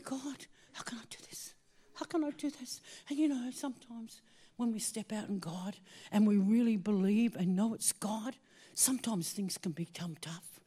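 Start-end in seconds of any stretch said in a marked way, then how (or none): 0.0-2.8 whispered desperate prayer; nearly crying whisper. Retold or imitated. Praying in despair on the cliff, whispered and near tears. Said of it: "I'm saying". 8.8-10.6 hushed aside. Acted.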